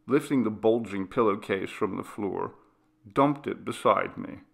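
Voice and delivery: ordinary delivery, nothing marked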